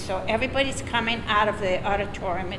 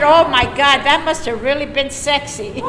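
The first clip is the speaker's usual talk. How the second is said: loud, laughing mock awe; mock her with a laugh; laughing sarcasm; 6 semitones higher, 8 dB louder than usual. Daughter teasing her mother, loud laughing sarcasm. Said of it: "I said".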